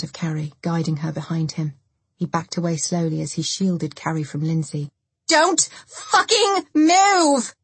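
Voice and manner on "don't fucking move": high, loud, drawn-out scream; screamed command. A narrator's reading. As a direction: scream it slowly, each word stretched. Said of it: "Lindsay screamed".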